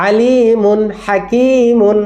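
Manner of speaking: কওমিন হাদিন drawn out very slowly, with the n kept clear; slow drill reading. Shown as a demonstration. Teacher modelling for the children, slow and clear. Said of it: "teacher drilling Quran reading slowly".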